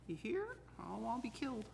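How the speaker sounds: high squeaky mock plea; mock pleading. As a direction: beg in a jokey high voice. Joking plea not to be killed.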